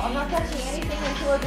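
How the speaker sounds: ordinary talk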